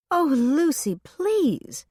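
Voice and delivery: high, whiny begging plea; beg, voice climbing high; pleading. A narrator's reading. Elfrida begging over phone.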